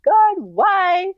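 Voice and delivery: high, pleading retold cry of despair; tearful cry to God. Alone on her bed, crying out to God, high tearful plea. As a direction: replay the anguished plea, voice climbing high. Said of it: "lying there crying".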